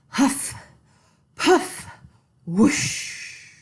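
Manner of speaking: huff puff whoosh. A narrator's reading. Wolf blowing the house down.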